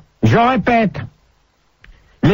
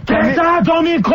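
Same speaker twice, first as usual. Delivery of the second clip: higher-pitched, exasperated command; irritated order; 6 semitones higher than usual. Real, not acted. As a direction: demand silence from an unruly caller. Host orders caller to mute. (ordre agacé, voix qui monte)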